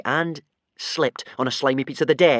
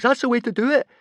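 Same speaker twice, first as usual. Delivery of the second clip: high, louder excited cheer; 6 dB louder, faster than usual. A narrator's reading. Boy cheering Hiccup from the side, high and excited. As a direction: cheer your friend on. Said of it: "cheered Fishlegs".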